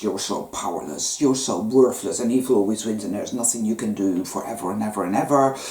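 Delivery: voice of shame. Acted. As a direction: speak as the shame that tells you you're worthless. Voicing the toxic shame construct.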